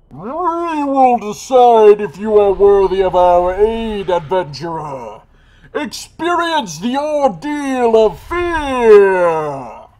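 slow, loud, high ghostly boom. Acted. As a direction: thunder it out like a spirit judge. Booming spirit voice, game scene.

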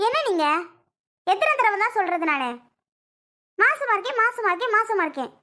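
exasperated anger. Acted. Wife sick of repeating her news, exasperated. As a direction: rattle it off in irritation. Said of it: "கோவப்படக்கூடாதுடி".

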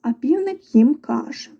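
usual speaking voice

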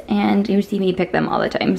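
usual speaking voice

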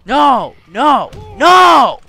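high, very loud repeated cries, building; panicked shouting. Real, not acted. Player under attack in game, panicked shouting. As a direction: yell each one harder.

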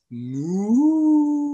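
high, loud puppet moo; cow moo. Pretend. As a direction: moo like a toy cow for the kids. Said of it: "and she says".

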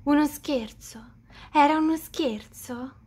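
breathless whispered disbelief; stunned whisper on hearing it was a prank. Acted. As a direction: whisper it, not believing it.

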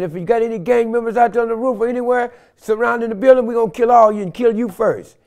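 imitating a SWAT officer shouting commands; retold SWAT shout. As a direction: yell it like a cop with a gun drawn. SWAT officer threatening him.

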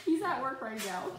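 high voice breaking into laughter; laughing. Real, not acted. Laughing at her baby picking the lock, giggling. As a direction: talk through giggles.

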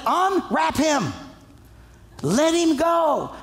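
high, slow deliberate order; Jesus ordering the man unwrapped; slow emphatic command. Retold or imitated. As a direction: weigh every word. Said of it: "And Jesus said".

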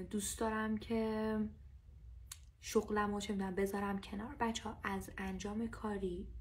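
usual speaking voice

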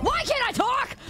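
high-pitched frustrated whine; exasperated outburst. Real, not acted. Streamer fumbling words on stream, exasperated outburst. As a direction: burst out high in exasperation at yourself.

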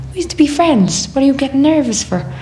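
incredulous protest. Retold or imitated. Acted reply to nervous old friend.

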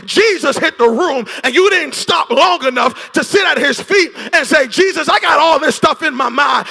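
loud, high, shouted preaching over music; preaching at full force. Acted. Preacher exhorting the church, shouting over the organ. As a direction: drive it home like a revival preacher.

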